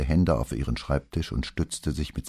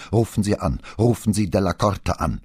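raised, insistent command; loud, higher-pitched urging; louder order; 7 semitones higher, 5 dB louder than usual. A narrator's reading. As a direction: repeat the order louder, pressing for action. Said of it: "diesmal lauter".

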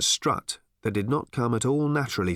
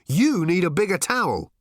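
higher, emphatic comeback; bickering retort; 6 semitones higher, 3 dB louder than usual. A narrator's reading. Arthur and Ford bicker over towels, retorting. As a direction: top the other's objection.

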